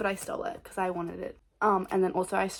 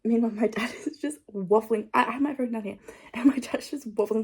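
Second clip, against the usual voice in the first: normal talking voice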